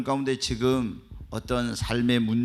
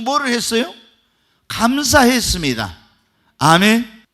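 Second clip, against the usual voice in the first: louder, higher, ringing declarations; full-force preaching; 7 semitones higher, 11 dB louder than usual. Acted. Pastor exhorting the church about thanksgiving, full-force preaching. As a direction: drive each line home to the congregation.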